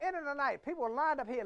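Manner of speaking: ordinary delivery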